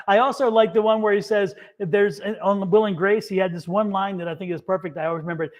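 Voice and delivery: normal talking voice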